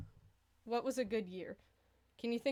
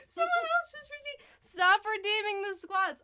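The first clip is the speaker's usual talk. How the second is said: high, loud, slowed complaint; whiny, pleading protest at the viewers; exasperated plea; 11 semitones higher, 6 dB louder than usual. Real, not acted. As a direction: beg the chat to stop, half laughing in frustration. Viewers keep redeeming squat penalty.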